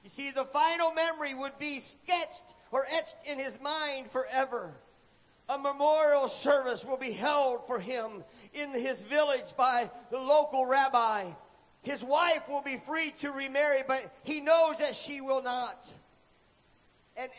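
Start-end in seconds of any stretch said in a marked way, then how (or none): none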